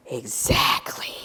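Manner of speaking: soft, slow whisper; whispered aside. Real, not acted. Speaker confides to the audience, slow whisper. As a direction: lean in and breathe it.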